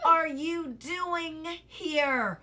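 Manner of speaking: growled. Shown as a demonstration. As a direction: drag it out as a growl. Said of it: "Growling and growling at the watchdog".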